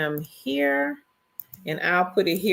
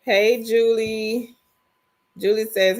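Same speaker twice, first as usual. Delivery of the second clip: ordinary talk, nothing marked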